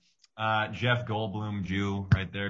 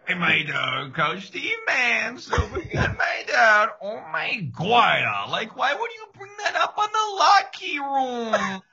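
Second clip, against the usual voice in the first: high, loud, dopey coach voice; Coach Steve; 13 semitones higher, 3 dB louder, slower than usual. Acted. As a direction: shout like an excitable clueless coach. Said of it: "your Big Mouth impressions".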